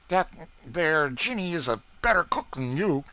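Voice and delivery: talking through a mouthful; boy speaking round food; mouth full. A narrator's reading. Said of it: "speaking with his mouth full".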